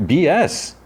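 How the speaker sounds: high, mocking call-out; scoffing. Real, not acted. Vlogger mocking the director's claim, high and scoffing. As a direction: pitch it up and scoff. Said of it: "calling out the lie".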